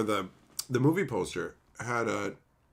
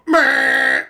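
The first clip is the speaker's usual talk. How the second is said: sudden loud high "Meh" burst; mock jump scare; 14 semitones higher, 13 dB louder than usual. Shown as a demonstration. Sneaking up behind a friend, a loud mock scare yell. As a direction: blurt it out to startle someone. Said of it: "If I come up behind you and go".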